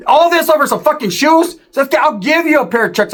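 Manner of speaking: high, incredulous rant; can't believe this is over shoes; exasperated. Retold or imitated. Narrator retelling his rant to the homies, high and exasperated.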